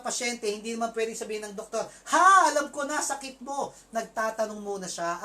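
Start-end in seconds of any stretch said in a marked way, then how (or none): 2.1-3.7 loud, high, cocky snap of a guess; know-it-all doctor. Retold or imitated. Doctor to new patient, a cocky know-it-all guess. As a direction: brush the patient off like you already know.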